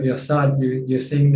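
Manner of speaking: ordinary talk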